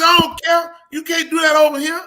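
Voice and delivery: high, loud mock fury; imitated mad man. Mad man scolding another in the locker room, imitated fury. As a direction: play up his outraged telling-off. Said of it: "Poor man was so mad".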